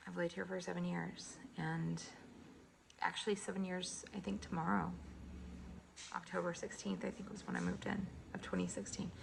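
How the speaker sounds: almost a whisper, holding back crying; emotional. Real, not acted. Vlogger tells viewers she is leaving her home, softly close to tears. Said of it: "that's making me even more emotional".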